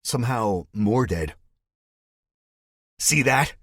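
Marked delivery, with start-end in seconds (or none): 3.0-3.6 loud, higher gloating call; taunting shout. Acted. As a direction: show it off to the crowd. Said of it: "Spike says".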